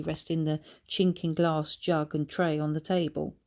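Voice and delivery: plain reading voice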